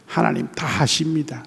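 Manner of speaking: soft hushed whisper; reverent whisper. Real, not acted. Pastor to congregation about the almighty, whispered. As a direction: breathe it in awe. Awed confession of God's power.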